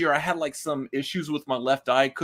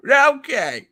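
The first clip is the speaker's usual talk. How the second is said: put-on loud, high cranky old man; 10 semitones higher, 7 dB louder than usual. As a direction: grumble back like an old man. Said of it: "this old man thing here".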